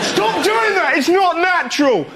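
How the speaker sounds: high outraged squeal over a magazine; high shrieking passenger. Retold or imitated. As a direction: shriek it like an outraged lad on a plane. Imitating annoyed passenger on plane.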